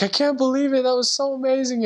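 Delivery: imitating companion's high, amazed gush; mock amazement. Companion marvels at the sleeper: high mock amazement. Companion reacts in retold scene.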